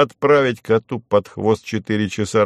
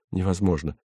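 softer, lower scared whisper; frightened whisper; 4 semitones lower, 7 dB quieter, faster than usual. Acted. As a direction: gasp it out in fright. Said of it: "испуганно прошептал Выбегало".

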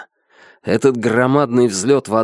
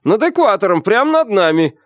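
high-pitched, excited confirming cry; emphatic confirmation; 10 semitones higher than usual. A narrator's reading. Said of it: "подтвердил первый помощник".

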